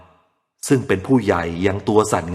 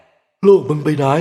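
higher, louder demanding question; accusing villager; 6 semitones higher, 4 dB louder, much slower than usual. A narrator's reading. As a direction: confront him sharply.

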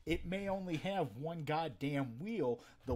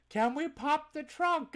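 customer's voice; 13 semitones higher, 9 dB louder than usual. Retold or imitated. Customer's eager question.

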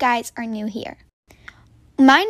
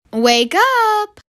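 wake-up call; 7 semitones higher than usual. Acted. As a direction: rouse the sleeping campers. Counselor wakes the girls.

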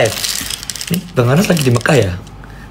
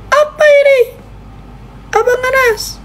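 very high squealed surprise; shocked squeal; slower than usual. Real, not acted. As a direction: shriek it in disbelief. Gift suddenly arrives on live. (pekikan kaget yang sangat tinggi)

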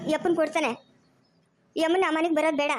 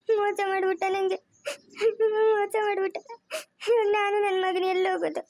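acted weeping with sobs between lines; crying; 3 semitones higher than usual. Deceived wife mourning the betrayal to those around her, sobbing. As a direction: lament it through tears. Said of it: "ತಿರ್ಗಾ ಅಳ್ತಾ ಇದ್ಯಾ".

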